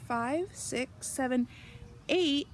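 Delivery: ordinary delivery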